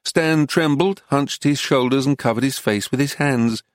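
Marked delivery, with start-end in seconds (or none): none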